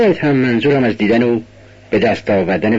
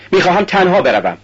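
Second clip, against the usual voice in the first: angry shout; 7 semitones higher than usual. Acted. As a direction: snap at her to stay behind. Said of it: "با صدای خشمالودی فریاد زد". (فریاد خشمگین، بلندتر و زیرتر)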